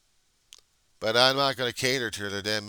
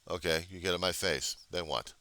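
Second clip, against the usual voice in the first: ordinary talk, nothing marked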